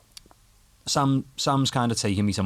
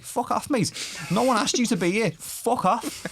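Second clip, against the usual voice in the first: mock fed-up snap; 13 semitones higher than usual. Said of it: "I'd just be like".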